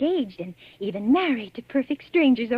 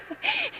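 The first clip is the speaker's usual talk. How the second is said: high giggle; 5 dB quieter than usual. Acted. Spinster flattered by stranger.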